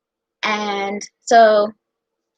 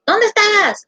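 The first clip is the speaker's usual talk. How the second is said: mom's call; 12 semitones higher, 3 dB louder, slower than usual. Retold or imitated. Mom calling asking where.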